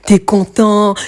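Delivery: ordinary delivery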